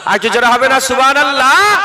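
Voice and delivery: loud rousing call to the crowd. Acted. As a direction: demand a bigger response. Urges crowd to chant louder. (শ্রোতাদের জোরে ডাক)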